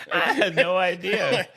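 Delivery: high, breaking up with laughter; can barely get words out for laughing; laughing hard. Real, not acted. Laughing at the wheezing bit.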